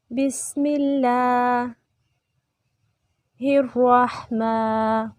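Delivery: slow, measured chant with long held vowels; Quranic recitation. Shown as a demonstration. Teacher recites for students to repeat, slow chant. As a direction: intone each phrase and stretch the endings.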